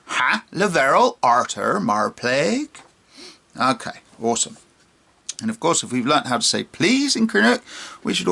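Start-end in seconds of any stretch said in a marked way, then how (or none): 0.1-2.7 Cornish pirate accent, higher and louder; an old Cornish pirate accent. Shown as a demonstration. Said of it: "And say again".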